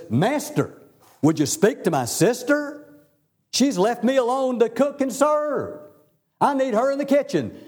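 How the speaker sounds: put-upon sister appealing for help, pitched high; exasperated complaint. Acted. Martha's acted complaint to Jesus.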